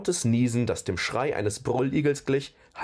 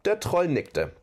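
usual speaking voice

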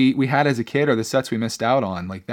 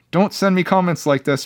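higher-pitched playful plea; playfully pleading, pitched up; mock protest; 6 semitones higher, 3 dB louder than usual. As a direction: pretend to tell him off. Mock protest, then reversed.

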